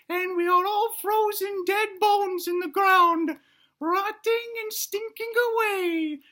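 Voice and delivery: high, slow falsetto oration; little dinosaur prophet. Acted. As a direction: proclaim it to the crowd in a tiny squeaky voice. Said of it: "he says".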